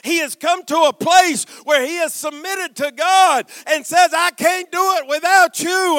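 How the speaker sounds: loud, high preacher's shout, building; full-force preaching. Real, not acted. Preacher telling the congregation God started the fight, full-force shout. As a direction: drive each phrase out like a revival preacher.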